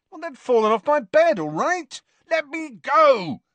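indignant yelling. A narrator's reading. Ron hangs upside down and protests, yelling. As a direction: yell to be released. Dangling Ron quarrels, demands release.

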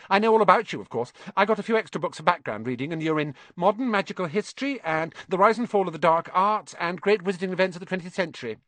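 high, rapid, eager recital; bossy know-it-all girl, showing off. Acted. Hermione telling Harry she read about him, eagerly bossy. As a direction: reel off book titles like a keen schoolgirl.

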